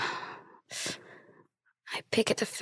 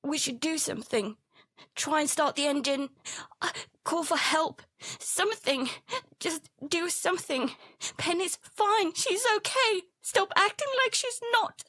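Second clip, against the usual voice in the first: rising higher and higher, frantic; begging harder with each line; desperate pleading; 7 semitones higher than usual. Acted. Kid pleading with frozen dad to act, rising panic.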